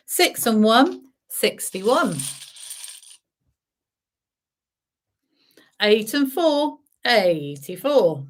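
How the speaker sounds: bingo caller calling numbers. Acted. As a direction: call each number out to the room.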